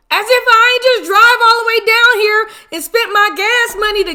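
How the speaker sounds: outraged, can't believe the nerve; exasperated disbelief. Real, not acted. Venting about paying for food.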